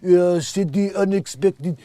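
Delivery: put-on slow, loud French-accented gibberish; over-the-top French babble. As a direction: mangle it in a thick mock French accent. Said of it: "when he's gonna go French again".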